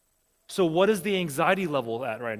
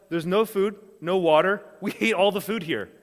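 mock upset complaint; 4 semitones higher, 6 dB louder than usual. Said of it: "you start saying crazy stuff".

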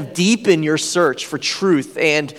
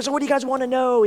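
higher, quick easy question; relaxed host; 8 semitones higher, faster than usual. Retold or imitated. Host casually invites questions, higher and quick. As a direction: toss it off casually. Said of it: "like, super cool".

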